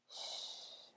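very soft, voiceless hush; soothing whisper. A narrator's reading. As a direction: hush someone toward sleep. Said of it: "Hansel whispered".

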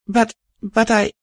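trying to get words out; Star voice. Acted. Star stammers.

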